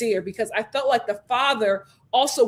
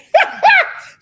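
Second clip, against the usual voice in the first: loud laugh; 10 dB louder than usual. Real, not acted. Host cracking up at the mother, loud laugh. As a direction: burst of high loud laughter.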